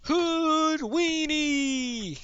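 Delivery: name stretched long and high; arena announcer. Acted. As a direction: draw the fighter's name right out.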